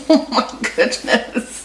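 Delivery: breathless laughing exasperation. Real, not acted. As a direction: laugh it out, half whispered. Struggling to open stuck lid.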